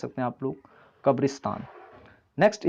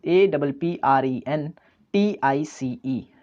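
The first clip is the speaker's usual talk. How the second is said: slow spelling; slower than usual. Shown as a demonstration. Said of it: "spelling a word aloud".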